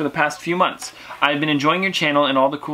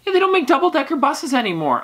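very high, squeaky put-on pitch; high-pitched comic incredulity; mock disbelief; 11 semitones higher than usual. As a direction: playfully send the voice way up high. Playful disbelief about London buses.

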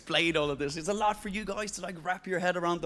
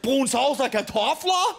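mock Danish; 8 semitones higher, 6 dB louder, much slower than usual. Mock Danish Christmas talk.

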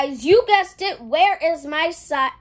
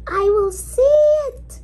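much higher and louder, drawn out; high excited squeal; 13 semitones higher, 8 dB louder, slower than usual. Acted. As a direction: squeal it out slowly. Excited announcement while digging.

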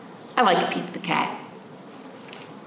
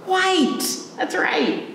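cheerful praise; 10 semitones higher, much slower than usual. Acted. Praising children's answer.

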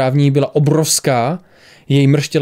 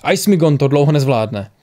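plain reading voice

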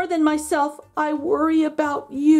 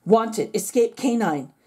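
usual speaking voice

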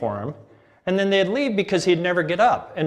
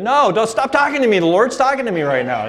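much higher and louder on no, then settling; exasperated son snapping; 6 semitones higher, 5 dB louder than usual. Retold or imitated. Son to parents offering lunch, higher louder exasperated snap. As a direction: cut them off, leave me alone.

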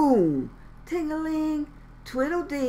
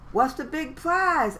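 eager question; 8 semitones higher, 3 dB louder than usual. Acted. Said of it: "asked Froggy".

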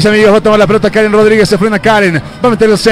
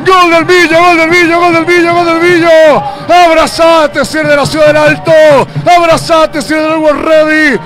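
goal celebration; 7 semitones higher than usual. Real, not acted.

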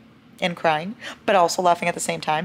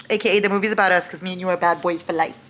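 ordinary talk, nothing marked

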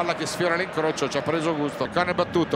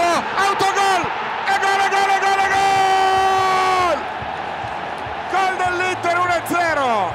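goal call at a full scream, much higher and louder; goal celebration scream; 13 semitones higher, 6 dB louder, slower than usual. Real, not acted. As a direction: yell the goal, dragging out each "gol". Goal scored, commentator erupts.

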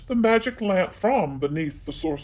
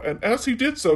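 ordinary delivery, nothing marked

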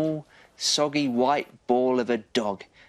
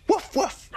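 high, rising woof; small dog's yap, pitched high; dog bark. Acted. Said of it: "barked Patch".

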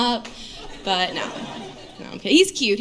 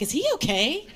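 high, worried partygoer voice; mock concern; 6 semitones higher than usual. Party guests asking about comic's boyfriend, mock worried. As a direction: imitate alarmed onlookers, voice raised in pitch.

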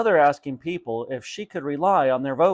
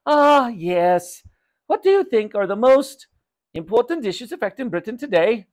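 grand mother voice, pitched high; loud, lofty questioning; self-important mother; 8 semitones higher, 5 dB louder than usual. Acted. Mother puts her clever question to shopkeeper, self-important.

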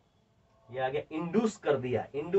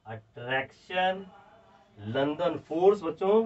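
very slow, word by word for students to write; slow dictation; much slower than usual. Shown as a demonstration. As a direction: dictate each word and wait for the pens. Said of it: "तो बच्चों लिख दूं". (बच्चों को धीरे-धीरे लिखवाते हुए)

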